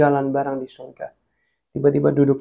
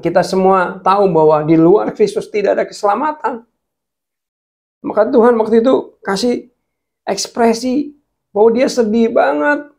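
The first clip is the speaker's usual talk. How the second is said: impassioned preaching; 7 semitones higher, 5 dB louder than usual. Real, not acted. Preaching climax on God's grief.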